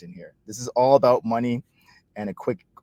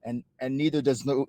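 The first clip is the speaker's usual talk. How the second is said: ordinary talk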